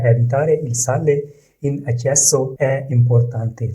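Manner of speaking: very slow, each word laid out for learners; slow model reading. Shown as a demonstration. Teacher reading the Italian example to learners, slow and careful. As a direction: read it slowly so students can repeat. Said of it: "teacher models Italian sentence".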